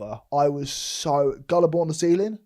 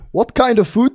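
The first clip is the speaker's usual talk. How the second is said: put-on high, loud South African accent; 9 semitones higher, 9 dB louder than usual. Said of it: "South African accent".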